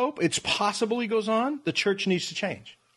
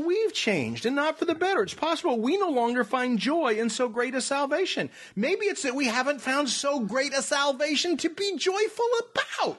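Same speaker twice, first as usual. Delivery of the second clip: lively, higher-pitched read-aloud; animated article reading; 5 semitones higher than usual. Real, not acted. Cohost reads and paraphrases the article, animated and higher. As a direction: lift it as if carried into the article. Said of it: "I thought you jumped into the article".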